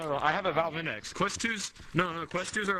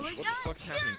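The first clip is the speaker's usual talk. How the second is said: shocked; faster than usual. Real, not acted. Panicking at glitching game.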